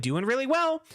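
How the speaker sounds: high, chipper greeting; friendly caller. Retold or imitated. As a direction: imitate an over-friendly phone caller. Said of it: "she was so friendly".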